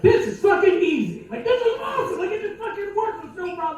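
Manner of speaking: gleeful at the easy win; excited. Retold or imitated. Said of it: "And I'm excited".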